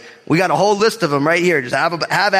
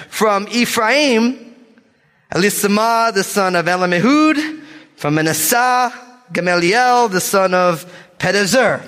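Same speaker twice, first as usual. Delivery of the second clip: bold scripture reading; 4 semitones higher than usual. Real, not acted. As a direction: draw each name out, landing on the end. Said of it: "gonna read these names with boldness".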